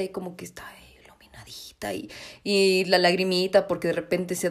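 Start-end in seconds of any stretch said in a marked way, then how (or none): 0.0-2.1 soft, faster whisper; much softer, whispered pity for the dying deer; hushed whisper. Real, not acted. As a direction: whisper it like at a deathbed.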